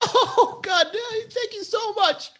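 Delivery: high-pitched mock sobbing gratitude; hammed-up tearful thanks; fake crying. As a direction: cry on demand, badly faked. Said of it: "I want you to cry".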